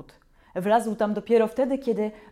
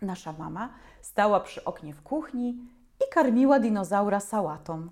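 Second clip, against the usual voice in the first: ordinary delivery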